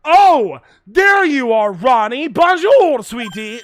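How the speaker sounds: high, loud, gushing greeting; sweet theatrical lady. Acted. Desiree greeting Ronnie, a high gushing coo. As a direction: coo his name loudly like a doting lady.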